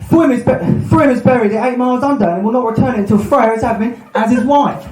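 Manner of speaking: high, declaiming myth character; mock-epic god. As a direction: proclaim it like a hammy god, pitched high. Acting out the myth's dialogue.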